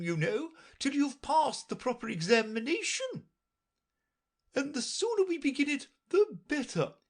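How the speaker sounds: very high, imperious royal voice; Red Queen. A narrator's reading. Red Queen lecturing Alice, high and imperious. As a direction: talk down to the child.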